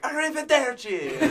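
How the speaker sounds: mock Italian yell. Band member imitating drivers who cut them off, mock Italian yell.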